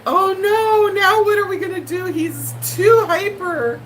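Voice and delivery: high, loud mock alarm; hammed-up worry over a hyper dog; mock panic about the hyper dog; panicky ad actress. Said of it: "And then she's like".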